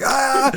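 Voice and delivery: high imitated yell; mock terrified scream. Gunner on the machine gun facing the monster, mock scream. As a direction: scream as if spat at, playing it for laughs.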